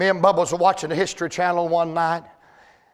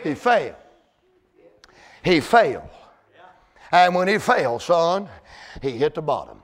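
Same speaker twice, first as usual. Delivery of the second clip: low dramatic preaching; 4 semitones lower than usual. Acted. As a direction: drop it down low and let it sink.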